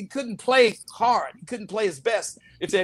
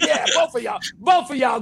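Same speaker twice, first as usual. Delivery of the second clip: higher, louder triumphant taunt; trash talk shout; 4 semitones higher, 3 dB louder than usual. Retold or imitated. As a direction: crow it after the shot drops. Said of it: "I was like".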